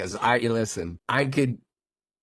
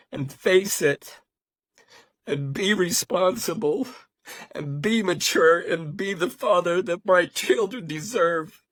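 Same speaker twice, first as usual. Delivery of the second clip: high, tearful voice breaking with joy; crying happy tears while speaking; 9 semitones higher, 3 dB louder than usual. Real, not acted. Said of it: "I know I'm crying, guys, but these are happy tears".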